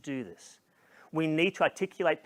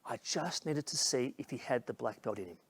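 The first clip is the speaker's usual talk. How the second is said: quiet, weighty voice of the trainer; retold confiding remark; 3 semitones lower, 8 dB quieter than usual. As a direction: softly reveal the reason behind it. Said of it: "And he said this to me".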